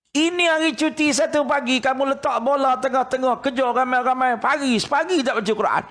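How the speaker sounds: mock scolding. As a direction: tell them off for skipping the Quran. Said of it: "dia kata".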